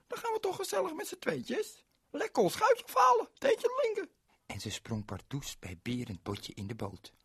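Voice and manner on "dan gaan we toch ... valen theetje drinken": put-on much higher, louder little girl voice. Ling introduces herself to Berend, high girlish voice. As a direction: play a bright small girl. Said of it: "riep het meisje Ling".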